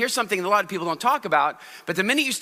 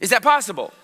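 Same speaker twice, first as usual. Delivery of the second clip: usual speaking voice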